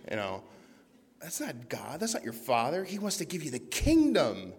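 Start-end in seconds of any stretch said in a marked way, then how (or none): 2.8-4.5 emphatic. Real, not acted. Emphatic sermon climax.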